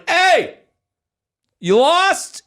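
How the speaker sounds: high New York street call; New York accent. Retold or imitated. Stranger hailing a lost traveler at the airport, Queens accent. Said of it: "like a New York accent".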